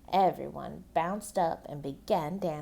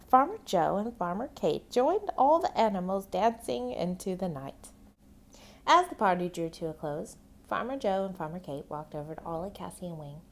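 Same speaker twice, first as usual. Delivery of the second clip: ordinary delivery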